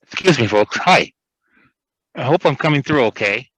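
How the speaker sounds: muffled, talking through a mask; through a face mask. Real, not acted. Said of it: "Trying to talk through a mask".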